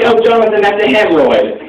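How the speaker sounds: unimpressed mom setting her son straight, lightly; mom's voice. Retold or imitated. Mom calmly telling her son it's a hemorrhoid, lightly voiced.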